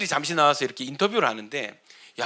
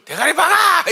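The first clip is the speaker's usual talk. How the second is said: barked order, much higher and louder; instructor's yell; 11 semitones higher, 6 dB louder than usual. Retold or imitated. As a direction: roar the punishment order at the squad. Drill instructor punishing recruits. (교관의 호통)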